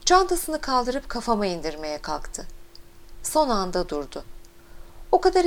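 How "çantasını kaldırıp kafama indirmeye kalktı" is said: usual speaking voice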